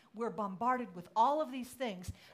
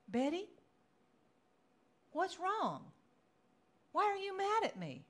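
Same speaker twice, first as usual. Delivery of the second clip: high-pitched, put-upon questioning; acted-out hurt, pitched well up; exasperated pleading; 11 semitones higher, 4 dB louder than usual. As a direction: retell a plaintive confrontation. Retells confronting her upset friend.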